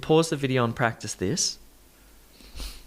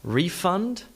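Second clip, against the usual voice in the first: refund as a noun, REE-fund, rising; noun stress on first syllable; 4 semitones higher than usual. Shown as a demonstration. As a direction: hit REE in refund with a full vowel. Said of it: "Refund is the noun".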